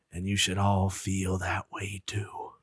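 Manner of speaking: hushed whisper, slow. Retold or imitated. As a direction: breathe the line out slowly. Closing line whispered.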